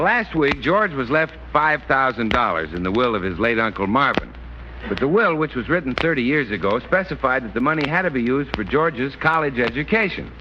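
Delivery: ordinary talk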